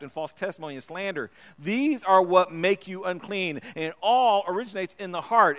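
loud, driving sermon delivery; fervent preaching. Acted. Preacher quoting Jesus to the congregation, loud and driving. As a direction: push the list out with conviction.

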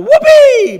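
high, very loud joyful whoop; yelled whoopee. Retold or imitated. Preacher imitating a new convert's hallelujah, yelled whoop. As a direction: holler it out like a sudden cheer. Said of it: "she just yelled out".

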